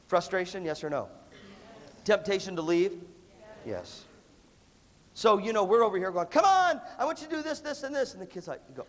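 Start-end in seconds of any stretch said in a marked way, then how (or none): 6.3-8.1 high, mock-exasperated demand; hammed-up impatient instructor; impatient urging. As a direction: bark orders at a kid, high-pitched. Acted impatient adult instructing kid.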